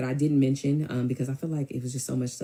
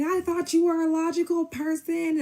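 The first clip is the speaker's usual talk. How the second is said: high, drawn-out mimicked holler; hammed-up high-pitched yell; mock yelling. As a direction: imitate him hollering, slow and very high. Mimicking his yelling in quarrel.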